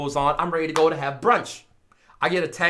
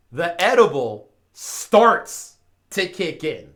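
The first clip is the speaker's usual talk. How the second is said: ordinary talk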